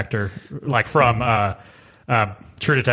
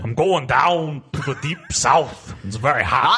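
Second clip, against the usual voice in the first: Walken doing Southern; 2 semitones higher, slower than usual. Retold or imitated. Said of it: "Christopher Walken try to do a Southern accent".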